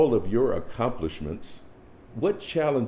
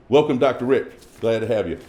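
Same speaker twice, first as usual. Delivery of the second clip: warm loud welcome; 4 semitones higher, 8 dB louder than usual. Real, not acted. Host welcoming guest on stage.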